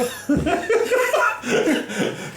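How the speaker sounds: high, helpless laughter; laughing fit. Real, not acted. Baker cracks up at her own Scottish accent, laughing.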